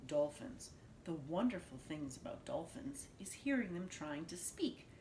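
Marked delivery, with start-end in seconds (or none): none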